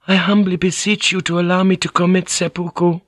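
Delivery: high, meek feminine plea; high-pitched submissive entreaty; humble beseeching. Acted. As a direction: beg formally in a high voice. Woman begging her lord for death.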